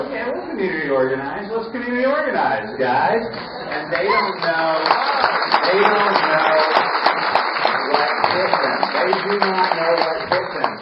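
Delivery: high, loud and fast rallying cry; rally shout. Real, not acted. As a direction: fire up the crowd.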